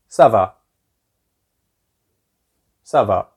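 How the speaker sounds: "ça va" with va dropping, a statement; falling answer tune. Shown as a demonstration. Teacher answers the French greeting, "va" falling at the end. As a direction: answer "ça va", pitch going down on va. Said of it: "Same, you go down".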